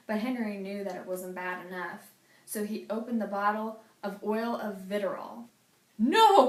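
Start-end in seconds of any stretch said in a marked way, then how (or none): 6.0-6.5 high loud alarmed cry; cried out. A narrator's reading. James seeing Henry pour the acid, crying out. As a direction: shout to stop him. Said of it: "cried James".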